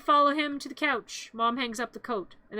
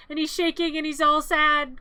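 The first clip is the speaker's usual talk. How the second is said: ordinary talk, nothing marked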